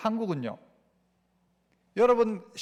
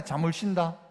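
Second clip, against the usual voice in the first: low, softer Gyeongsang dialect; 6 semitones lower, 5 dB quieter than usual. Shown as a demonstration. Preacher repeating God's joy in local speech, Gyeongsang dialect. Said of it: "경상도 사투리죠".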